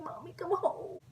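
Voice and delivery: high-pitched mock whimper to mom; tearful plea. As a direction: imitate a crying kid pleading, high. Crying child phoning her mother.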